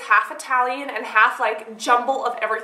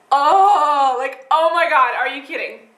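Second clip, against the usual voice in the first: dismayed; 4 dB louder, slower than usual. Retold or imitated. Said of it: "I'm just like".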